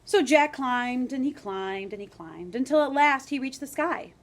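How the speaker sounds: building climb, rising to the sky; storybook narration. A narrator's reading. Teacher reading the beanstalk climb to class, building.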